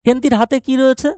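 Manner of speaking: normal talking voice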